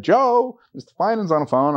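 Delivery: high, called-out name across the house; calling out. Retold or imitated. Wife calling husband to the phone, called out. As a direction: call him to the phone from another room.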